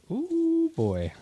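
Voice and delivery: high, delighted ooh; a thrilled gasp at a great pull; excited ooh. Real, not acted. Spotting a great card.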